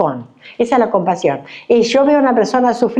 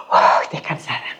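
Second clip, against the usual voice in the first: mock sigh of relief; 5 semitones lower, 5 dB quieter than usual. Suspiro imitado de alivio.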